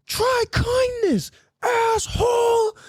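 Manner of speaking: very high, slow mock shriek; drawn-out squeaky imitation, hammed-up outrage; indignant woman. Line cutter's parting shot at the driver, mock-indignant shriek. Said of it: "she goes".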